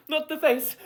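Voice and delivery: high, pleading mock terror; dramatic plea. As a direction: beg for your face, hammed up. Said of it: "I'm being a little bit dramatic".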